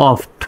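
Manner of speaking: "অফট" with an open o, closing on ft; model pronunciation. Shown as a demonstration. Teacher reads out O-F-T, "অফট" as a model pronunciation. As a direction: say "অফট" as one falling syllable. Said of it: "তার মানে হবে".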